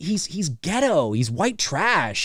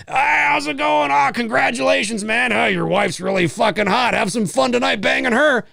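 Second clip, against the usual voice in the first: lightly voiced rude uncle greeting the groom; crude uncle; 6 semitones higher than usual. Uncle at a wedding toasting the groom, lightly voiced rude and crude. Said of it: "he walks up to the groom and he says something like".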